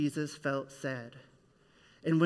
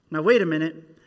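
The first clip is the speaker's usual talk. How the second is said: sudden realisation; 11 dB louder, faster than usual. Real, not acted.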